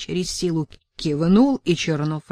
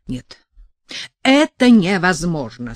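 exasperated outburst; 8 dB louder than usual. A narrator's reading. Sasha stamps her foot.

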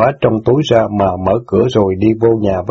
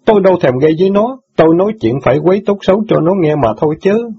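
higher, lightly voiced teen boy, hurt and reproachful; indignant boy; 8 semitones higher, faster than usual. Son reproaches sister for forgetting jailed father, lightly voiced. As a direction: scold her like a wounded son.

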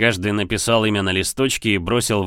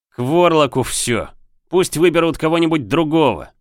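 loud, high furious refusal; angry outburst; 7 semitones higher, 4 dB louder than usual. Acted. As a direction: shout it in frustration.

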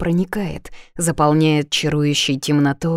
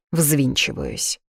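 ordinary delivery

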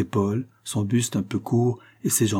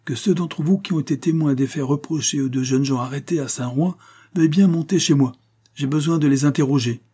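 higher, projected announcement to a crowd; raised voice of a mayor addressing onlookers; calling out; 6 semitones higher, 3 dB louder than usual. A narrator's reading. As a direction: summon witnesses across the yard. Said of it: "cria-t-il".

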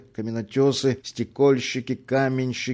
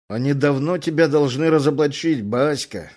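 ordinary delivery, nothing marked

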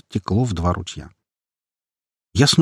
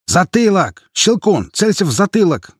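high, urgent shouting; shouted command; 9 semitones higher, 4 dB louder than usual. A narrator's reading. Squad leader directs the sniper's aim, shouting. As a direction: shout aiming orders over the battle. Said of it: "крикнул чех".

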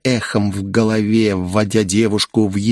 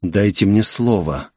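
ordinary delivery, nothing marked

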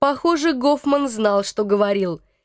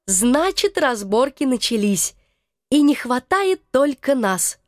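ordinary delivery

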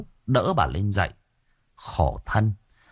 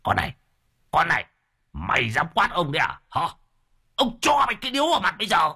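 building to a higher, fast threat; threatening old man; 7 semitones higher, faster than usual. A narrator's reading. Old husband threatening his wife, angry and building. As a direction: lunge at her with words. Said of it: "Lão sấn sổ".

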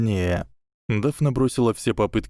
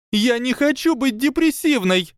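much higher, louder frantic yell; panicked shout; 5 dB louder than usual. Acted. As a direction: scream it in panic. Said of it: "закричала Панси". (отчаянный крик, очень высоко)

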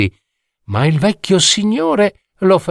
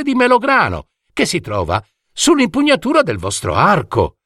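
put-on eager boy; 6 semitones higher than usual. Boy shows the queen's coins.

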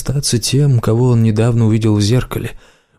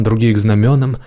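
usual speaking voice

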